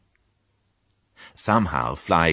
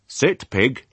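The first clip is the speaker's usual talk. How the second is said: farmer commanding; 8 semitones higher, 3 dB louder than usual. Acted.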